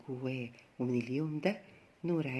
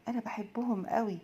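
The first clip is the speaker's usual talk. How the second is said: amazed; 8 semitones higher than usual. A narrator's reading. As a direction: gasp it out in childlike amazement. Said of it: "فتح عينه بدهشة وقال".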